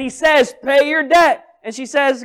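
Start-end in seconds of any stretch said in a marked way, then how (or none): 0.6-1.4 loud, high demand at the door; demanding creditor. Acted. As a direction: bark it like a debt collector. Acted creditor pounding on the door.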